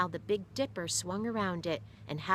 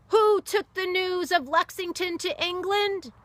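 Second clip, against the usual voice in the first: high, loud rallying shout; yelled question; 10 dB louder than usual. Acted. Sailor yelling questions at the crowd, high and loud. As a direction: whip up the crowd with it. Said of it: "yelled questions".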